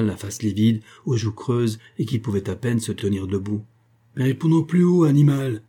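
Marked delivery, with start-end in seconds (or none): 4.2-5.6 higher, louder bark; guard's yell. Acted. Guard yelling at a weak convict, angry shout. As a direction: shout him down like a brute.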